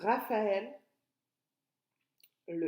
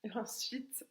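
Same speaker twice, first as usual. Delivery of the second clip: whisper; 2 semitones higher, 8 dB quieter than usual. Real, not acted. Whispered aside while writing.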